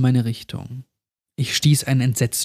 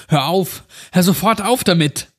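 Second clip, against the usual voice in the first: shouting; 5 semitones higher than usual. Acted. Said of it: "Ich schrie das kleine Mädchen an".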